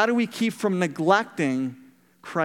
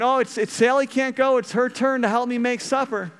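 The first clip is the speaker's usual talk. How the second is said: mild mock yell; 6 semitones higher than usual. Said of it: "Grandma yells from the kitchen".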